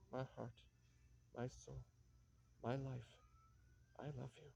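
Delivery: soft, fading plea to the wind; hushed prayer. Acted. Katya softly begs the wind to reach Boris, hushed and yearning. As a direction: breathe it out like a prayer.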